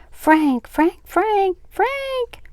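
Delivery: very high, sustained wailing cry; shrill scream. A narrator's reading. Aunt screaming as the scooter heads downhill, shrill scream. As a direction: shriek the name as if carried away. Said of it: "Her scream, shrill as a bird's cry".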